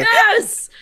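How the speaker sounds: loud, high burst of delight; real joyful cheer; excited shout. Real, not acted. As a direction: yell it out, thrilled. Reacts to Jamie Lee Curtis comparison.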